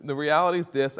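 normal talking voice

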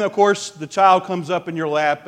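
normal talking voice

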